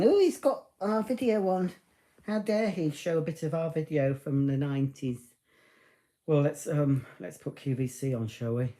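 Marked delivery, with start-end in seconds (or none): 0.0-5.2 high, huffy mock-posh complaint; mock BBC types. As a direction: lampoon a stuffy BBC executive. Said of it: "those B B C types saying".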